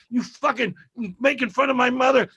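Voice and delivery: high-pitched mock outrage; angry accusation. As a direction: imitate an aggrieved guy accusing a comic.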